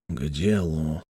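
low, soft hiss. A narrator's reading. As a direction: hiss it under your breath. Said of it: "Адамс прошипел".

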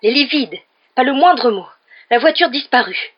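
high, loud, furious scolding; exploding rage. A narrator's reading. Mother scolding sons who sneaked off, exploding rage. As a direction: blow up at the boys, shouting each accusation. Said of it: "lorsque sa rage explosa".

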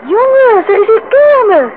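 high, loud, thrilled shout to the group; a gleeful shout of discovery; excited call. Acted. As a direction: call out the delight at finding a fair. Excited arrival at the fair.

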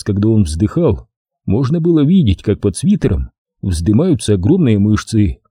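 ordinary delivery, nothing marked

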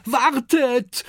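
high, loud call. Acted. Oskar calling to the departing raft, loud call. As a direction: shout it across the dunes. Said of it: "ruft er".

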